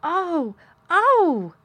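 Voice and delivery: high, loud cry of delight. Acted. Colin hears the creatures will come, crying out delighted. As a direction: burst out in joy. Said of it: "Colin cried out in delight".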